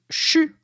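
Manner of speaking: "chu" as one syllable starting with sh; Quebec contraction. Shown as a demonstration. Teacher giving a Quebec example, "chu" as a contraction of je suis. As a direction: squash "je suis" into a short "chu". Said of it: "beaucoup de contractions".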